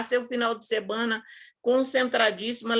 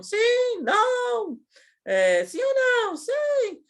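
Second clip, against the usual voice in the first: mocking sing-song; 13 semitones higher, much slower than usual. Mocking the trainer's yes-or-no quiz.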